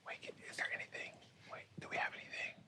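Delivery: urgent, quick whisper; real hushed table whisper; whispered aside. Real, not acted. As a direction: whisper quickly to your teammates. Hushed consulting between players.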